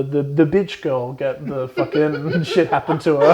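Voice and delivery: laughing through the words. Real, not acted. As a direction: giggle while saying it. Laughing at the mean girl.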